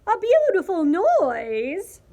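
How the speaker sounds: high, loud, slow swoops; crowing rooster. A narrator's reading. Rooster echoing the cockroach's wish, crowing loudly. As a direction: crow the question, stretching each word. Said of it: "crowed Rooster".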